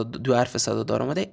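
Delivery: ordinary talk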